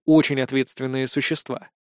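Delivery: usual speaking voice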